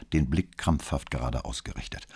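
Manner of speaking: ordinary delivery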